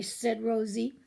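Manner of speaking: ordinary delivery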